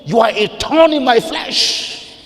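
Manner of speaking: high, loud, slow accusation; preached quote. Retold or imitated. Pastor throwing the wife's words back, high and loud. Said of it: "because you told him".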